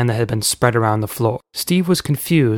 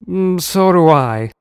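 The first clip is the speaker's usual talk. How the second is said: stubborn; 5 semitones higher, 4 dB louder, slower than usual. A narrator's reading. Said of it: "said Uncle Shameless".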